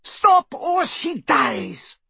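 high, drawn-out threat; threatening cry. A narrator's reading. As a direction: cry out a slow, high-pitched ultimatum. Villain threatens a hostage.

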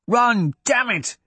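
high, slow, urgent shout. A narrator's reading. Hadrian shouting Royce toward the well, urgent. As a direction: drive them on with a yell. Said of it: "Hadrian shouted".

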